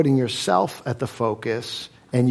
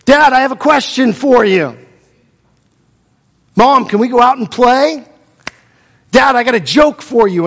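put-on eager kid; 8 semitones higher, 8 dB louder than usual. Kids pestering parents.

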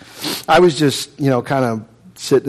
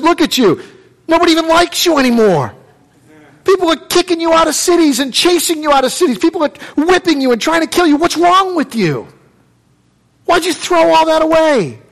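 much higher, scornful reproach; scornful brother; 13 semitones higher than usual. Acted. Seneca mocks Saul's fallen state, much higher. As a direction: pour scorn on him.

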